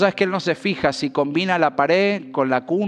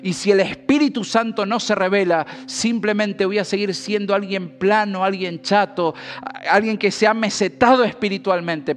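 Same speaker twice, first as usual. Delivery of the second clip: higher, pleading prayer to the Spirit; fervent prayer; 4 semitones higher than usual. Acted. Preacher voicing a believer pleading with the Holy Spirit, higher and fervent. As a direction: beg God earnestly to teach you.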